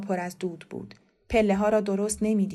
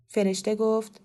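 ordinary delivery

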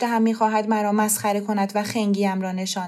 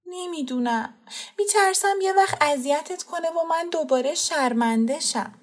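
hesitant; 8 semitones higher than usual. Acted. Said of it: "با تردید گفت".

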